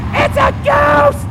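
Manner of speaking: slow, high, panicked shout; shouted in fright. Acted. Twelve disciples seeing a ghost, frightened shout. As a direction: stretch out a terrified yell. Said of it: "they shouted".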